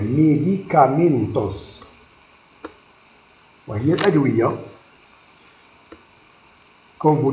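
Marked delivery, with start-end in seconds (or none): 0.0-1.8 Medicamentos said slowly, each syllable and vowel spelled out clearly; a teacher spelling out Medicamentos syllable by syllable; every letter pronounced. Shown as a demonstration. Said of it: "on prononce toutes les lettres et les voyelles".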